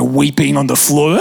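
usual speaking voice

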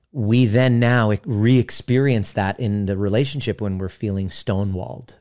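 ordinary talk

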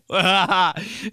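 loud, high mock laugh; exaggerated guffaw; put-on laugh. Reacting to dot dot dot text, mock laughing.

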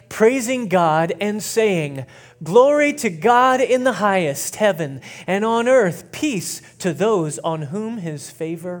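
loud, high, slow proclaimed reading; intentional scripture proclamation. Real, not acted. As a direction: proclaim it to the whole room, stretching key words. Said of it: "read it real slow and real intentionally".

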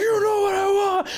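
put-on squeaky high childish taunt; childish wrestler. Mocking two wrestlers arguing, high childish squeak. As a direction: whine it like a kid fighting over a toy. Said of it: "You sound like fucking children".

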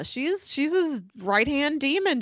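ordinary talk

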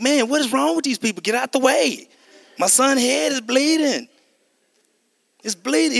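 high, pressing, panicked complaint; a father desperate to get through; frantic. Retold or imitated. A father frantic at the base gate line, panicked.

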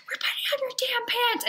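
fierce hissed whisper; angry whisper. Retold or imitated. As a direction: hiss it under your breath. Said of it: "And I'm like".

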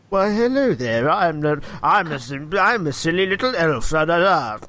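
sing-song silly puppet voice; silly little elf puppet. Acted. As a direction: play a daft elf in a puppet show. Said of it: "you just occasionally hear".

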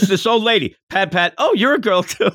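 high and chirpy, pleased discovery; childlike Goku voice. Retold or imitated. Host voices young Goku patting the old lady, high and chirpy.

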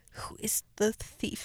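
slow, whispered mystery question; playful spooky whisper; suspenseful whisper. Pretend.

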